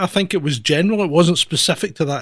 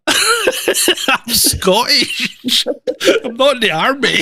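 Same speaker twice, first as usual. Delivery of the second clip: high-pitched, laughing reply; laughing through words; 8 semitones higher, 3 dB louder, much slower than usual. Real, not acted. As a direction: say it while cracking up.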